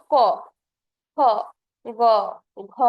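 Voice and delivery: ক খ গ ঘ each said alone with a pause, from the throat; teacher sounding velar letters ক খ গ ঘ for students; isolated consonant demo. Shown as a demonstration. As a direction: sound out ক খ গ ঘ one at a time. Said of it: "তুমি উচ্চারণ করো".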